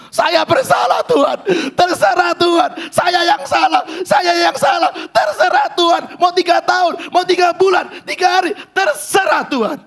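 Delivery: high, loud cries of repentance; anguished shout of surrender. Acted. David throwing himself down before God, shouting his guilt.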